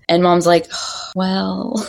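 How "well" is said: imitated hesitant sigh. Mom's retold reluctant reply.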